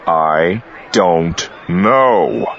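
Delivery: very slow, every word of "I don't know" bitten off separately; biting out each word. A narrator's reading. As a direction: spit out "I don't know" one word at a time. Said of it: "biting out each word".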